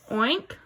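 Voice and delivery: playful oinking pig sound. Shown as a demonstration. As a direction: say it like a pig for the class. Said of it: "Who says oink?".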